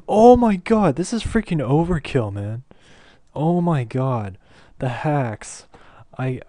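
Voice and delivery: loud, high disbelieving yells; shocked outburst. Real, not acted. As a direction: shout in stunned disbelief. Opponent lands game-ending hit.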